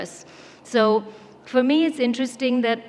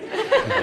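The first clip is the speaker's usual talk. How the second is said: a squeaky high giggle. Real, not acted. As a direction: let out a bright, high-pitched giggle. Laughing at her own sentiment.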